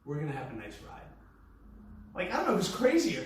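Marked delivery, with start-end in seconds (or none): 0.1-1.0 soft, even, calming tone; relaxed reassuring murmur; calm monotone. Retold or imitated. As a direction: settle the stranger down gently. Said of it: "me being so monotone, relaxed, and calm".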